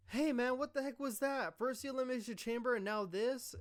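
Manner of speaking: high-pitched, whiny imitation of protest; put-upon griping, pitched well up; mock indignant complaint. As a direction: imitate an aggrieved man demanding answers. Retold wrestler's indignant complaint.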